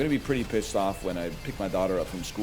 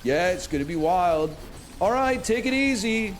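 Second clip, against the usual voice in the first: higher, louder chirpy voice; mocking young people; 9 semitones higher, 3 dB louder than usual. Streamer imitating young people chatting, chirpy voice. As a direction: ape a peppy college kid. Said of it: "Shit like".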